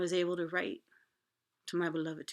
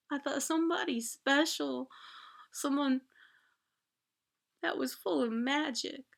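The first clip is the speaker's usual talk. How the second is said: higher and louder, slow, tearful; heartbroken crying plea; 8 semitones higher, 6 dB louder than usual. Retold or imitated. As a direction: pour it out like a betrayed child. Heartbroken outburst to mom.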